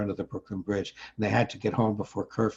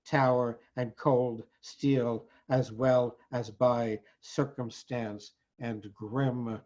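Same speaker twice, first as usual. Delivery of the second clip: poem recitation; 7 semitones higher, slower than usual. Acted. Poet telling of five thousand protesters trapped, solemn declamation. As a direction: declaim each phrase with deliberate pauses.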